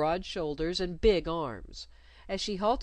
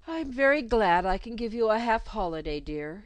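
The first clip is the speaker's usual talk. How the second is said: a put-on governess, higher and louder; fussy governess sounding a touch sorrowful; 4 semitones higher, 6 dB louder than usual. Governess Miss Royle speaking to her pupil, sorrowful.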